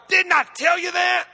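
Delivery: loud, high reproach; shouted rebuke. Acted. Preacher as Jesus, rebuking Martha with a loud shout. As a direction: throw the question back hard.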